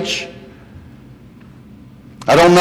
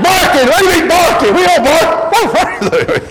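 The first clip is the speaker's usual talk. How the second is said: much higher, laughing through barking; laughing disbelief; 13 semitones higher, slower than usual. Retold or imitated. Preacher retelling his reaction to Ahmad, laughing high. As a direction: squeak out the words mid-laugh. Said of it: "I'm like".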